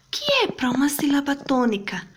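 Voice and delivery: high-pitched pupil asking teacher; voiced pupil question; pupil's question. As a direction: pipe up high like a puzzled pupil.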